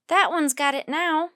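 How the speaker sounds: loud call while pointing; yelling. A narrator's reading. Annie yelling to Jack mid-chase, pointing. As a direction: shout it across the street. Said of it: "Annie yelled".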